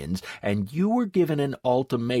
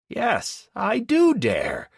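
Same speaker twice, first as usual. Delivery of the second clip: louder, higher, defiant reply; raised voice; 11 semitones higher, 4 dB louder, slower than usual. A narrator's reading. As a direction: push back hard, voice raised. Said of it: "I had also raised my voice".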